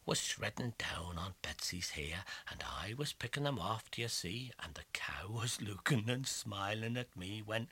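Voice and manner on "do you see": ordinary delivery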